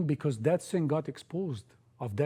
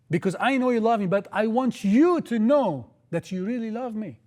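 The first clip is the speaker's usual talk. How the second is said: Jesus pleading, preached; 4 semitones higher, 5 dB louder than usual. Acted. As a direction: plead with Peter from the heart, full force. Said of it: "Jesus want to tell Peter".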